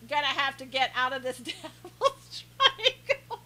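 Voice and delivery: laughter overtaking the sentence; laughing. Real, not acted. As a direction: crack up at your own joke. Cracks up at own joke.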